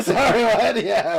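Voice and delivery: high laughing apology. Real, not acted. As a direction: laugh through it. Laughing at own slip.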